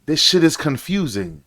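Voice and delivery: ordinary talk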